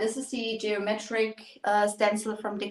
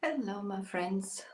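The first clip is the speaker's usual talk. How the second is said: soft whispered hello; whispering; 9 dB quieter than usual. Real, not acted. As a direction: breathe the greeting quietly. Whispered greeting opening live stream.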